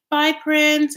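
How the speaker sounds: high, loud breezy farewell; Rosemary's goodbye. Retold or imitated. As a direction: toss it off lightly. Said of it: "she's like".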